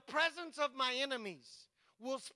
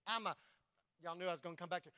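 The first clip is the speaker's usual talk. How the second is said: ordinary delivery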